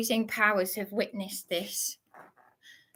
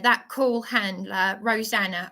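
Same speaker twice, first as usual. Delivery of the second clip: ordinary talk, nothing marked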